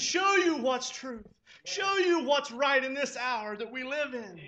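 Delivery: loud, rising prayer at full preaching force; impassioned pulpit prayer; fervent preaching. Acted. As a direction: cry out the prayer to the Lord.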